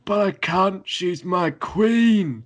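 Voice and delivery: mock-anguished lovestruck pleading; high, drawn-out, hammed-up devotion; Jon Snow. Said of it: "And he's like".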